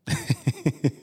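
a quick laugh at himself; short laugh. Real, not acted. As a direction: laugh lightly at the idea. Laugh after addressing himself.

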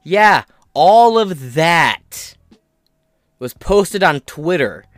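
loud and slow, hammering it home; slow down and push every word out; loud, slow emphasis. Real, not acted. Ranting emphasis.

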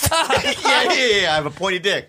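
breaking up with laughter; laughing. Real, not acted. Cracking up at his own improv, laughing. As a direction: talk through a high laugh.

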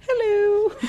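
very high, loud cartoonish hello; imitating Monica. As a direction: squeal a hammed-up high greeting. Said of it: "She goes".

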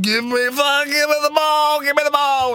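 demanding the ball. Retold or imitated. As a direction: bellow for the ball like a big centre. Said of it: "Bastareaud's like".

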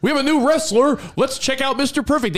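high, hyped wrestling announcer; cheesy old-school promo voice; announcer imitation. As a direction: sell the new wrestler like a TV promo. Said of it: "Like Mister Perfect, where they're like".

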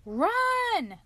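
high, loud warning shout; one long, urgent high yell; crying out. A narrator's reading. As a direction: cry out to flee. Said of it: "cried the robber".

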